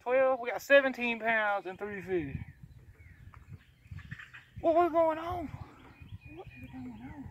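ordinary talk

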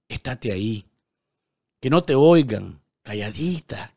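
heartfelt, emphatic poem recital with long falling phrases; poem reading. Acted. News host reads a viewer's poem to Nicaragua, heartfelt recital. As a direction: read it like a love letter to a homeland.

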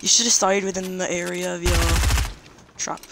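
a hint of a mock British accent, chatting up the enemy; put-on accent. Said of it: "I'm really gonna stop this accent".

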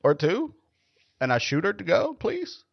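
sing-song polite request for a drink; mock sweet bar order. Coworker ordering margaritas at lunch, mock sweet. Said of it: "then they're like".

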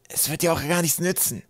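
high, choked character voice; stifled voice. A narrator's reading. Trapp pressed into the net below, stifled voice. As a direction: speak as if squashed face-down. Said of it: "mit erstickter Stimme".

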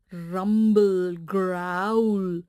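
low rumbling growl sound. A narrator's reading. Tummy growl sound effect.

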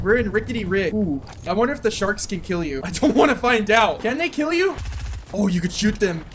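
ordinary talk, nothing marked

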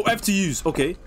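usual speaking voice

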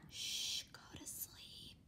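unvoiced, very soft whisper; whispered hush. Parent hushes the crying kids at night, whispered. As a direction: soothe them to sleep.

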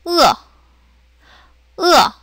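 brief falling 으어; fourth tone. Shown as a demonstration. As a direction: say 으어 in Mandarin fourth tone. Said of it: "사성해서".

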